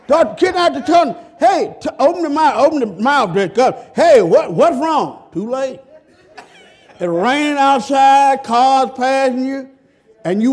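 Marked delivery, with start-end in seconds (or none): 1.4-5.2 louder, higher shouting at the car; mock yell. Preacher plays a driver shouting at his stalled car, louder. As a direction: holler at a dead car.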